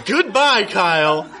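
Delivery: mock outraged goodbye; storming out. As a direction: leave him with wounded dignity. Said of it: "I stormed out".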